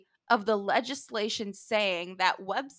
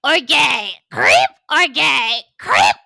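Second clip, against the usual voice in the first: loud mock deliberation; 3 semitones higher, 9 dB louder, slower than usual. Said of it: "They were like".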